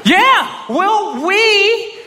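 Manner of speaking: high, slow and laughing; accepting the tryout while laughing loudly; giddy reply. Retold or imitated. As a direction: stretch it out, barely holding in the laugh. His own giddy reply to the team.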